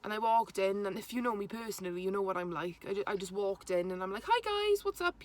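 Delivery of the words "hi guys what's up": breezy greeting. Retold or imitated. Her own breezy greeting retold.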